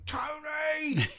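high, loud wailing shout; whiny wailing cry. Retold or imitated. Drummer wailing to the tour manager, high whiny cry. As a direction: wail his name like a spoilt child. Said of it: "Nico's going".

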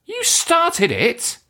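high, slow exasperated cry. Real, not acted. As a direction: whine it out in frustration. Exasperated outburst.

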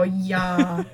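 long drawn Wisconsin "yeah"; mom's Wisconsin accent. Retold or imitated. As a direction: imitate mom's thick Midwestern vowel. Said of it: "Sometimes I hear her go".

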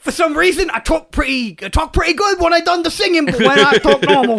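high, broad comic British voice. Acted. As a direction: put on a silly British accent, pitched high. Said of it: "[name], you're much less attractive when you speak".

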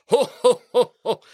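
hearty Santa belly laugh, high and loud; jolly ho ho ho. Acted. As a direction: boom out a jolly ho ho ho.

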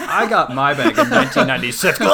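mock a smug know-it-all; snobby guard. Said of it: "being a total dick".

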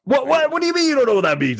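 high, quick incredulous outburst; rapid-fire disbelief. Real, not acted. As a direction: blurt it out in disbelief, pitched high. Incredulous at being misunderstood.

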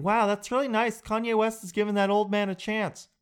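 lightly voiced dumb youngster; mock millennial. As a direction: parrot clueless kids. Mocking clueless millennials.

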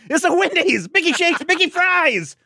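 squeaky high-pitched mock plea. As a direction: pitch way up like a whiny kid. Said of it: "And I'm like".

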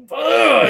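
high, loud exasperated "ugh"; acted-out yell at the phone; frustrated scream. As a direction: let out a strained groan of frustration. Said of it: "I'm just screaming at my phone".

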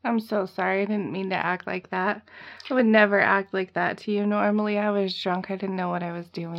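a hint of a fake-remorseful boyfriend; mock contrite apology. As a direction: play the sorry abuser, softly. Voicing the abuser's apology.

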